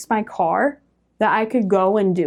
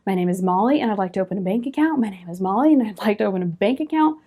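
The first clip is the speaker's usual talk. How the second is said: rehearsing; faster than usual. Retold or imitated. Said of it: "I'm rehearsing in my head".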